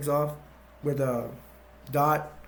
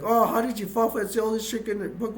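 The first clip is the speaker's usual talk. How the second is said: higher, quick incredulous jeer; mock scolding; 7 semitones higher, faster than usual. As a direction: tease someone who fell for a trick. Villain gloats after tricking the hero.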